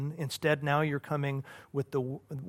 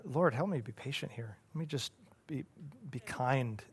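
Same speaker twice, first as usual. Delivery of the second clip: softer, hushed plea for patience; a soft, humble little prayer; quiet prayer; 8 dB quieter than usual. Retold or imitated. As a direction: pray it under your breath. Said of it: "I'm having to go".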